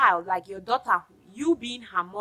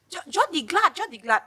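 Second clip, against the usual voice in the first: mock friend; 7 semitones higher, 4 dB louder than usual. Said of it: "you start telling me".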